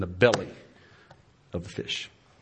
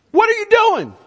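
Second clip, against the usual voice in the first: much higher, loud outraged question; exasperated shout; 8 dB louder than usual. Acted. Spouse confronting napping partner, loud outrage. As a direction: demand it at full pitch. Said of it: "coming in and going".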